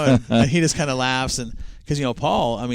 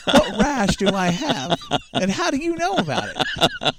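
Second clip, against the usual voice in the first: slow, high, laugh-broken words; laughing through it; 12 semitones higher, slower than usual. Retold or imitated. Retelling the driver's panic, slowed by laughter. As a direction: try to get the line out while cracking up.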